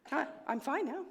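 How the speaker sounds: ordinary talk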